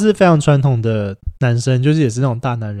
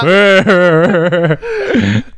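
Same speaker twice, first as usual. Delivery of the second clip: higher burst of real laughter; 6 semitones higher than usual. Real, not acted. Guest laughs after being called ugly, higher laugh. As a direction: laugh it off, pitched up.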